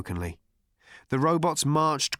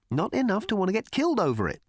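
fast, higher, offhand; Ford's retort; 9 semitones higher, faster than usual. Acted. Ford dismissing the old man's plea, fast and offhand. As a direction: toss it off quickly.

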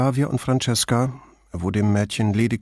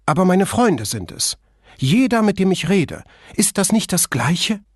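higher voiced Flavia getting worked up; upset protest; 8 semitones higher than usual. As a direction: push back at him, rising in distress.

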